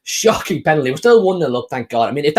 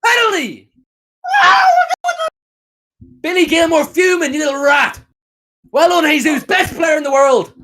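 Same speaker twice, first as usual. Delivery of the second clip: high gloating shout; jeering cheer; 12 semitones higher, 5 dB louder than usual. Real, not acted. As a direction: crow at the rival player. Celebrating the penalty call.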